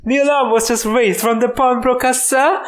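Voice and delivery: put-on high-pitched French-accented policeman; hammy, high French accent. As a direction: question them officiously in a put-on French accent. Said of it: "Why do you have a French accent?".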